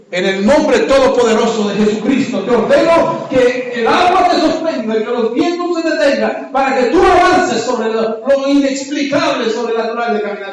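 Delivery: fervent sermon decree; preaching at full force. Acted. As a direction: proclaim it like a command from the pulpit.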